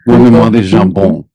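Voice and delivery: playful put-on French; mock French accent. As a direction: show off some joke French with a French accent. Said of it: "your French accent is so good".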